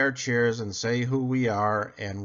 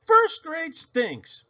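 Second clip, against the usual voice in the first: much higher, slow, drawn-out whine; sulky kid verdict; 4 dB louder, slower than usual. A narrator's reading. Girl declaring her new grade awful, slow high whine. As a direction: stretch it out like a pouting child. Complaint to friend.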